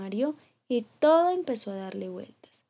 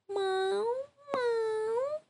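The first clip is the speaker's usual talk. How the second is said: high, soft plaintive meow; 12 semitones higher, 4 dB quieter than usual. Acted. Cat on the branch mewing high and soft. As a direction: mew quietly like a frightened kitten.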